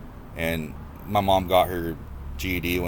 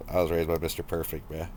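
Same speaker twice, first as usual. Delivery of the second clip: normal talking voice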